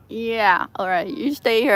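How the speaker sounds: ordinary talk, nothing marked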